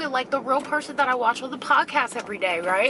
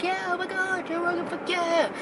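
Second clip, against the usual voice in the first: mock fan crowd; 6 semitones higher, 4 dB quieter than usual. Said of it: "everybody's like".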